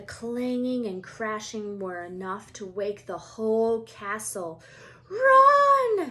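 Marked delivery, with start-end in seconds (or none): 5.1-6.1 much higher, loud shout; yell. Acted. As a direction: yell at them to flee. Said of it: "Harry yelled".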